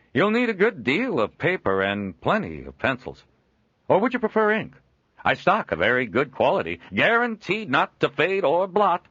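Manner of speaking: a jovial trader chuckling at his own likeness; Joel, amused trader. Acted. Joel selling wares.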